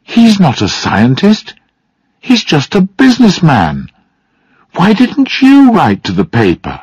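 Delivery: high-pitched, indignant daughter; indignant challenge. A narrator's reading. Daughter argues with her father.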